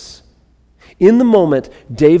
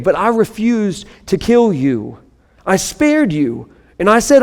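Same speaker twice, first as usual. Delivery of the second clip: raised, pleading reading of David's words; impassioned scripture reading; 4 semitones higher than usual. Acted. Pastor voicing David confronting Saul, raised impassioned reading. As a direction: plead with the king from the cave mouth.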